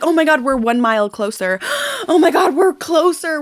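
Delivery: thrilled little gasp at each mile; giddy road-trip excitement, laughing; excited gasp. Retold or imitated. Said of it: "you're like".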